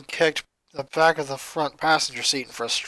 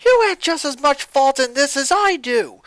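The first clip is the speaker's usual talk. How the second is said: indignant retort; 8 semitones higher, 4 dB louder than usual. Acted. Lori offended retort.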